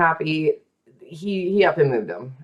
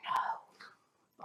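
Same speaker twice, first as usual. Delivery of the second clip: breathy whisper, much softer; 18 dB quieter than usual. Acted. Teasing a mystery to her cohost, whispered. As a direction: let the word vanish into a whisper. Spooky trailing end of mystery.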